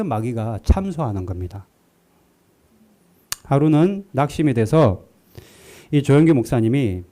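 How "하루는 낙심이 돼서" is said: ordinary talk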